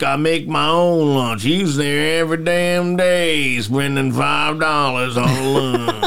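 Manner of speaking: slow, drawn-out mock rural drawl; exaggerated rural accent. Mocking guys overheard at the bar, exaggerated rural accent. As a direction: stretch it out like a backwoods local. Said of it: "They're talking like this".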